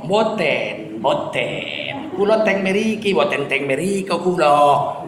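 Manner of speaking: low, blunt Batak-accented Javanese denial; lightly done Batak accent on Javanese. Shown as a demonstration. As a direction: deny it like a Batak speaking Javanese. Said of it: "kalau bahasa Jawa pakai logat Batak".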